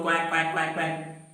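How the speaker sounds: lightly imitated run of quacks; duck quack. Teacher speaking as the duck, lightly imitated quacking. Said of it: "I would make my sound".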